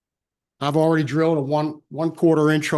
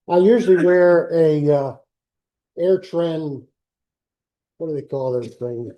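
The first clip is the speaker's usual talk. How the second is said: ordinary delivery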